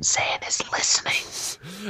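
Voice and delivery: hushed, unvoiced aside; whisper. Real, not acted. Hushing about children.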